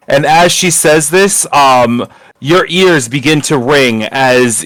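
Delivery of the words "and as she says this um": normal talking voice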